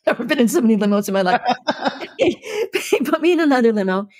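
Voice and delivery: real laughter breaking through; laughing. Real, not acted. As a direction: giggle while you tell it. Laughing at her own story.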